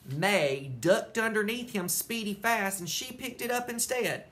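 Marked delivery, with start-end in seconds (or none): none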